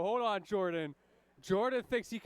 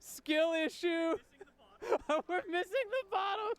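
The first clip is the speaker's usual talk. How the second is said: laughing taunt; 10 semitones higher, slower than usual. Real, not acted. Laughing at opponent.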